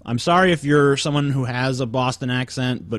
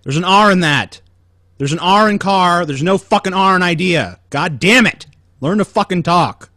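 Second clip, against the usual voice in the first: angry rant; 7 semitones higher, 7 dB louder than usual. Real, not acted. Ranting about Boston accents.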